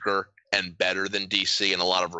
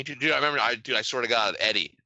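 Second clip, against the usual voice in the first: ordinary talk, nothing marked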